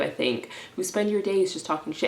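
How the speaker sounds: ordinary talk, nothing marked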